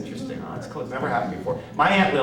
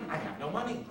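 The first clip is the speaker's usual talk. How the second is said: put-on softer, higher, zoned-out old woman; Aunt Lil; 4 semitones higher, 12 dB quieter than usual. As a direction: repeat it faintly like a confused patient. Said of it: "my Aunt Lil would sit there like this".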